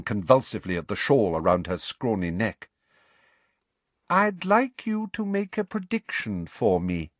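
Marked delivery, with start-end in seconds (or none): 4.1-7.1 high, slow, each word crisply spoken; very clearly. A narrator's reading. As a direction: spell it out slowly and primly. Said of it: "said Professor Umbridge very clearly".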